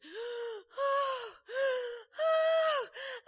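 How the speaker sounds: a loud, high gasp of sudden breath; huge high gasp. Retold or imitated. Healed lady, amazed, drawing one huge high gasp.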